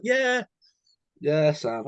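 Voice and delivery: high-pitched mimicked reply; lightly mocked high phone answer; imitated host on the phone.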